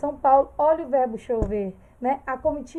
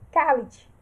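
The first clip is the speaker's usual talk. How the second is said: firm, slightly louder "Cale-te"; curt command; 4 dB louder than usual. Shown as a demonstration. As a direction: snap out a short order. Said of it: "ponto de exclamação ou final".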